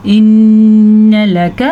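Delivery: इन्न लक with n hummed long through the nose; इन्न लक, sustained nasal n, short ka; long ghunna. Shown as a demonstration. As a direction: chant इन्न लक slowly, holding the nn. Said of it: "काफ को लंबा नहीं करना".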